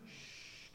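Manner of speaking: very soft drawn-out shush. Acted. Someone demanding decorum, soft silencing shush. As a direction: hush them into behaving. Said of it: "someone in your life shushing you".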